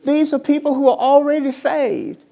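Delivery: preacher building to a peak; preaching. Real, not acted. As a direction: preach it to the congregation. Preaching at full force.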